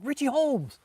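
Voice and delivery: high, loud call of a full name. Retold or imitated. Friend hailing him in the Army dining hall, calling out. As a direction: holler the name as if across a room.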